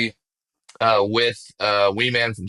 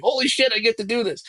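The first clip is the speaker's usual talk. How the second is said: much higher, giddy burst of excitement; thrilled disbelief; 13 semitones higher, faster than usual. Retold or imitated. The speaker reacting to a dream job, a much higher excited burst. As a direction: blurt it out like a fan who can't believe his luck. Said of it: "I'm like".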